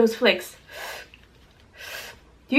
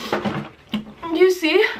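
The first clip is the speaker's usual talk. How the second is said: slow, drawn-out rising question; lingering teary ask; 5 semitones higher, much slower than usual. Real, not acted. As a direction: stretch it out, pointing at the tears. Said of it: "Those are spicy tears".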